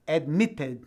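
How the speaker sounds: "Admitted" in British English with a hard T; British accent, release the T clearly; British, marked T. Shown as a demonstration. Teacher showing students the T, British accent. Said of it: "com um T bem marcado, como se fosse o inglês mais britânico".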